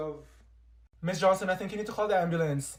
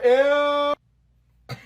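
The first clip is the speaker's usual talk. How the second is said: disgusted; 7 semitones higher, 10 dB louder than usual. Acted. Gum fell on the floor.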